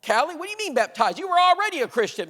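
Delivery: high, loud, acted indignation; screaming mother. Mother to daughter over her baptism, screaming. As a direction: imitate an outraged mom scolding her daughter. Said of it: "her mom's screaming at her".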